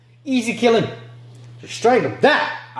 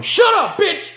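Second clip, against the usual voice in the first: high, sudden furious yell; explosive high-pitched outburst; angry shout; 12 semitones higher, slower than usual. Acted. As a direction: yell to silence someone. Acted yell at screaming grandma.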